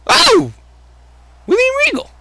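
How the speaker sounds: very high squeal of surprise; delighted surprise. Real, not acted. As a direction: yelp at the sudden arrival. Surprise character appears.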